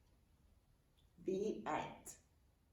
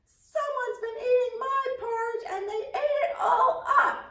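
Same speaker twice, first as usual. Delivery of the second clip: very high, loud squeaky little bear cry; Baby Bear's wail; 7 dB louder than usual. A narrator's reading. Little cub crying to his parents about the empty bowl, high and loud. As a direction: wail it like an upset tiny cub. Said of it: "And Baby Bear says".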